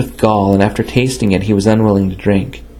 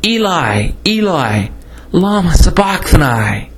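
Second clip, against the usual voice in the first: a raised, pleading call, not truly loud; crying out; 6 semitones higher, slower than usual. A narrator's reading. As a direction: lift the pitch and cry the words out slowly at the end. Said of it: "Jesus cried out with a loud voice".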